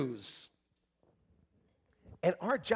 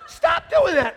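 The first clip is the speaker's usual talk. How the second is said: much higher, louder mock scolding; hammed-up parent telling teen off; mock parent yell; 6 dB louder, slower than usual.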